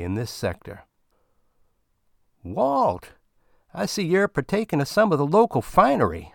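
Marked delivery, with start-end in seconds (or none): 2.4-6.3 much higher, quicker, jovial greeting; Bill grinning as he greets Walt in the bar; grinning old comrade. Acted. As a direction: greet him like a beaming old friend.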